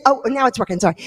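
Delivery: ordinary delivery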